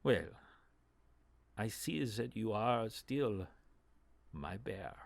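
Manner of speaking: slow, soft knowing purr; lightly voiced drow, drawn out; drow NPC voice. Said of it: "and she says".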